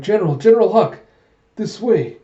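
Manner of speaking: high, loud hail to the general; urgent calling out. Acted. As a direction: call him over urgently from a distance. Ally calls out to escaping general.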